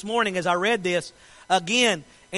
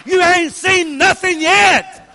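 slow, loud, high shout; shouted declaration; 7 semitones higher, 7 dB louder, slower than usual. Acted. Preacher shouting the climax to the congregation, slow and loud. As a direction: hammer it home, drawing every word out.

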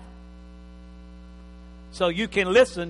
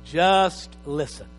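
slow emphasis; 2 semitones lower, much slower than usual. Acted. Preacher slows for emphasis.

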